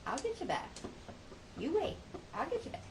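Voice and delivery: high-pitched mock threat; high little warning, acted out; playful threat. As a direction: vow sweetly to get your revenge. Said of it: "I think".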